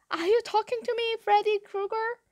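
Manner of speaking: high, quick, incredulous taunt; taunting challenge. A narrator's reading. Bully answering the smaller kid, high taunt. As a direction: challenge him mockingly.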